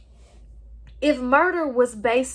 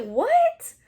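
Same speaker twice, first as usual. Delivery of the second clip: much higher, imitating a stunned "What?"; imitating a shocked stranger; mock shock; 11 semitones higher than usual. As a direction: squeak it out like someone caught off guard. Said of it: "They're so shocked".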